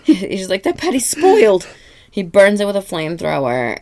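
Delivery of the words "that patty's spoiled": high, loud mock alarm; alarmed outburst. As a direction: blurt it out as a lightly acted alarmed boss. Acts out Mr. Krabs' alarm.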